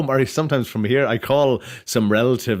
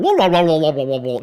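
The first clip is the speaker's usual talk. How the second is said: mock Scottish babble, louder and higher at first; imitating Scottish mumble; 4 semitones higher, 4 dB louder than usual. Mocking a Scottish friend's accent to the host, as hammed-up babble. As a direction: parody a hard-to-follow Scottish friend with nonsense syllables. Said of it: "So Michael's".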